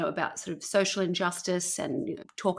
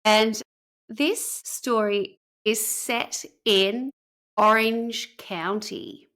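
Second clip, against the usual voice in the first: slow, drawn-out announcement; slow dramatic reveal; much slower than usual. Acted. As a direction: drag out the reveal.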